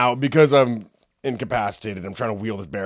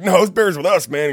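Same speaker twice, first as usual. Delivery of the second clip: high, loud, insistent denial; loud, high-pitched mock indignation; retold loud protest; 9 semitones higher, 10 dB louder than usual. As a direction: act out protesting to a cabbie. Retold protest to cab driver.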